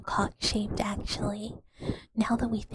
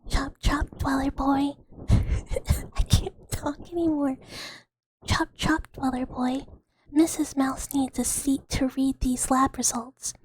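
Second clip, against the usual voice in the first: bossy mommy mouse; 4 semitones higher, 3 dB louder, slower than usual. Acted. Bossy Missus Mouse roleplay.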